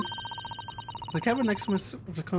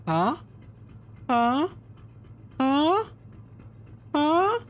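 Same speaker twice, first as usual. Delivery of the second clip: each huh higher and louder; rising prodding huh; 9 semitones higher, 3 dB louder, much slower than usual. Acted. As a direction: keep pushing for the laugh, climbing each time. Fishing for a laugh after the pun.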